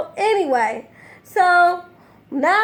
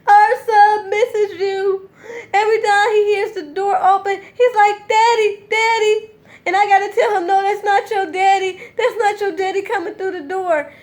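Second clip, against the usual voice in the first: high, hammed-up weepy whine; imitating a tearful mother; mock crying plea; 6 semitones higher than usual. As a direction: fake sobbing to guilt him. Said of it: "Talking about".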